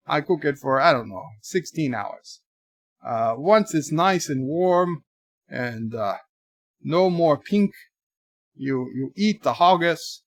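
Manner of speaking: normal talking voice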